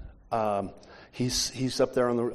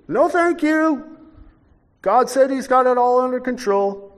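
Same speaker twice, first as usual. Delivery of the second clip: prissy refusal; 11 semitones higher, 7 dB louder than usual. Retold or imitated. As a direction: squeak it out primly. Roof man, pitched up prissy.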